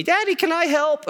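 put-on high-pitched small kid voice; little son. Imitating his sons coming up to dad, high child voice. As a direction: pipe up like an eager little child. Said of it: "my sons come up to me".

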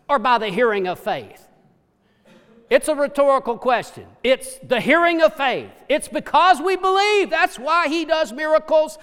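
preacher pressing the answer; preaching. Acted. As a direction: drive the point home to the crowd. Preaching at full force.

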